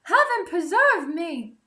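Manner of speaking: put-on high, loud girlish cry of alarm; startled cry of Rapunzel. A narrator's reading. Rapunzel startled by the prince at her window, crying out high and loud. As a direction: gasp it out as a frightened maiden. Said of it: "cried Rapunzel".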